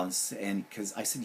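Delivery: ordinary delivery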